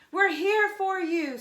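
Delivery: high, loud cheering yell; screamed. Acted. Anna yelling encouragement to Rico on the rope, high and loud. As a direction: shout it up to him on the rope. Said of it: "screamed Anna".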